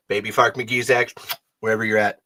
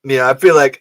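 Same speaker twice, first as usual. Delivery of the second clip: ordinary talk, nothing marked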